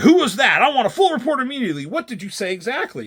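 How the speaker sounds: mock-furious interrogation; pissed off. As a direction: fire off the questions like an irritated boss. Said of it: "He's kind of, like, pissed off".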